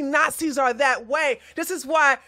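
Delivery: heated, rising rant; angry condemnation. Real, not acted. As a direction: lay into them, building to the final insult. Scolding viewers who support them.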